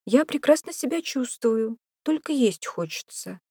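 high-pitched female character voice; protesting woman. A narrator's reading. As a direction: insist you're fine, voice lifted. Alarmed woman insists she is fine.

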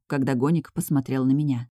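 plain reading voice

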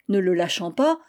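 ordinary delivery, nothing marked

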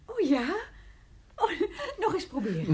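giddy with the trick; delighted laughing. Real, not acted.

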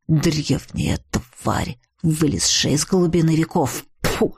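low, slow, contemptuous spitting; hateful. Acted. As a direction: drag it out low with loathing. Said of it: "глядя на Уришаса с ненавистью".

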